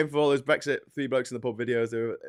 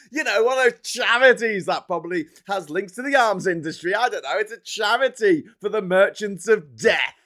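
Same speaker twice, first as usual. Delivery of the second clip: sarcastic rant; 6 semitones higher, 7 dB louder than usual. Real, not acted. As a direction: spit out each charity with contempt. Angry rant about think tank.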